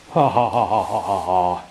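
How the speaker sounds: imitating a man's smug "ha ha ha"; a mocking, boastful laugh like a man showing off with a guitar. Retelling the bearded man's boastful laugh, mock laugh. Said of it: "in a boastful way".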